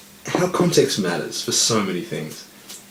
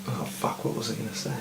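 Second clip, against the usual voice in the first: much softer, half-whispered searching; hushed whisper; 13 dB quieter, faster than usual. Real, not acted. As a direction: whisper to himself, trying to recall. Losing his thought, going hushed.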